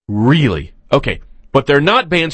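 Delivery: ordinary talk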